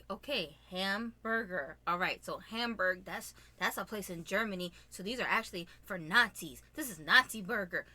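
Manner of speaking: low-pitched mock explainer; imitated over-analyzing husband. Husband analyzing his wife's hamburger, mock lecturing.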